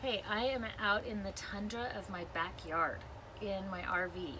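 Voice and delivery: usual speaking voice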